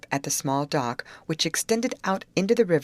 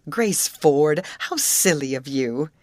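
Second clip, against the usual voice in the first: scolding; 5 dB louder, slower than usual. A narrator's reading. Betty scolds Grace over log.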